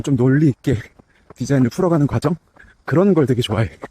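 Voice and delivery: talking mid-jog, a bit winded; while running. Real, not acted. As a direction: speak while running at an easy pace. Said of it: "이제 막 뛰기 시작했고".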